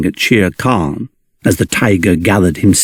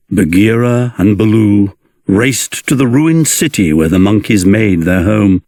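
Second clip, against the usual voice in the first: ordinary delivery, nothing marked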